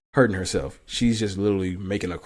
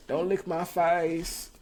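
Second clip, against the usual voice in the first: high, slow whiny protest; mock whine; 7 semitones higher, 4 dB quieter, much slower than usual. Real, not acted. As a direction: drag it out like a whine at the dog. Dog licking his face.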